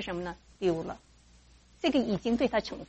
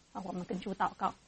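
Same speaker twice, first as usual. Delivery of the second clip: ordinary talk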